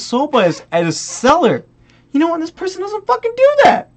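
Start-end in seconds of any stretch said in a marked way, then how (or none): 2.1-3.9 very high, strained exasperation; indignant squeal. Real, not acted. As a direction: voice shooting up in outrage. Indignant rant about the buyer.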